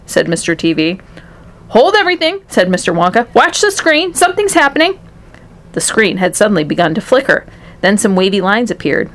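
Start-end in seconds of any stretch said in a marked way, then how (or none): 1.7-2.4 high, excited exclamation; urgent alert. A narrator's reading. Wonka halts everyone, something happening. 3.3-5.0 high, urgent Wonka; excited alert. A narrator's reading. Wonka alerting others to the screen.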